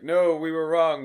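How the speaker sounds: high, loud, slow call; shouting across a theatre. Acted. Imitating Squiscar standing up mid-recital, shouted plea. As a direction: call out an urgent apology to the stage. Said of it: "They're all shouting from various locations".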